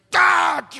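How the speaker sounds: a startled pained scream; sudden scream. Retold or imitated. Wife in labor screaming at her husband's touch. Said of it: "she's like".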